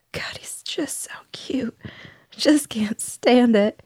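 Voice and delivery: slow whispered gush, overwhelmed by cute; cooing over cuteness. Real, not acted. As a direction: breathe it out, melting. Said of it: "I've never looked at something and just wanted to cry".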